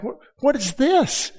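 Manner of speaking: bewildered high what is this; jolted, asking what hit me; startled at my own words; surprised. Retold or imitated. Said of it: "I'm like".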